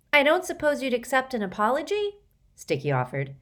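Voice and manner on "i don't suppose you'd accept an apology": cheeky. A narrator's reading. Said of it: "A cheeky response".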